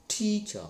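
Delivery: "Teacher" with TEA high and strong, cha falling; first-syllable stress. Shown as a demonstration. Teacher corrects learners, "Teacher" stressed on TEA. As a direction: hit TEA, let cha drop in "Teacher". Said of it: "I am stressing on tree".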